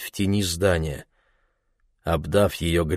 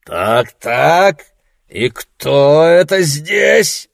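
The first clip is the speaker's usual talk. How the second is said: put-on loud, slow, slurring drunk; drunken voice; 7 semitones higher, 8 dB louder, much slower than usual. A narrator's reading. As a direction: boom it out like a drunk lout. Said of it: "прогремел пьяный голос".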